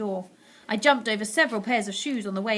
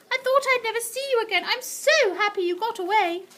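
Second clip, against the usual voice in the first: higher, louder, tender; relieved grandmother; 12 semitones higher, 4 dB louder than usual. A narrator's reading. Grandmother hugging grandson, tender and relieved. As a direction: hug them with your voice.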